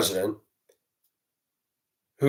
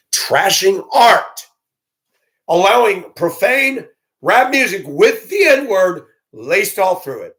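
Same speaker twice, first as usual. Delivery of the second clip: louder and higher, drawing out each word in disgust; loud slow outrage; 8 semitones higher, 5 dB louder, slower than usual. Real, not acted. Ranting to viewers about profane rap, loud and slow outrage. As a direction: spit out the list slowly, fuming.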